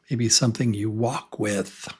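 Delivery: normal talking voice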